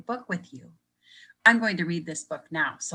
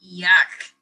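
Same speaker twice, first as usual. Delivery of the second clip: disgusted yuck; 10 dB louder than usual. Acted. Disgust at ocean ooze.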